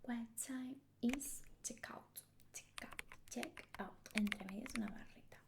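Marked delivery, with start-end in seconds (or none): 0.1-4.1 "checkout" drawn out slowly, whispered, and repeated; slow whispered model of "What time is checkout?"; slow pronunciation demo. Shown as a demonstration. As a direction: slowly spell out the sound of "checkout". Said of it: "Acuérdate de escribirlo así".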